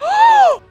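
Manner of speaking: very high, loud gasp; shocked gasp. Real, not acted. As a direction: gasp loudly in horror. Reacts to in-game attack.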